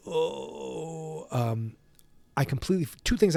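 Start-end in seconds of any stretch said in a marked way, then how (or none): 0.1-1.3 winded groan, much softer; a gut-punched oof, soft and high; mock groan after a kick; pained groan. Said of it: "Marty's like".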